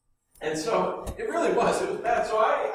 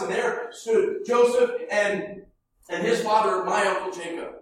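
ordinary talk, nothing marked

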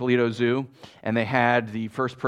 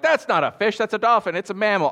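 higher, mildly mock protest; pedantic objection; 7 semitones higher, 4 dB louder than usual. Listener correcting the word fish, higher-pitched mock objection. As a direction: correct him in an acted know-it-all way.

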